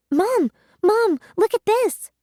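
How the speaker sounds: high, loud, thrilled call; excited shout. A narrator's reading. A girl shouting for her mom to see the living slime, excited.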